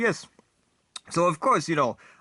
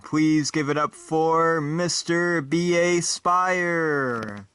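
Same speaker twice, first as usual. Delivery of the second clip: show announcer; 4 semitones higher, much slower than usual. Acted.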